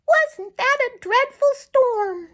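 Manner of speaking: very high little bird voice, eager; a tiny bird spotting food; chickadee voice. Acted. Tommy Tit the chickadee speaks.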